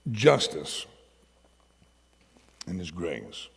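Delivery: ordinary talk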